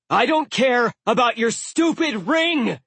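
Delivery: high, loud angry yell. A narrator's reading. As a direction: shout him down. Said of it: "Blitz yelled".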